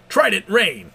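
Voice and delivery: shouted move name; attack shout. Acted.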